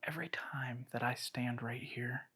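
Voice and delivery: hushed whisper. Real, not acted. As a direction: whisper as if not to disturb. Cold spot, hushed.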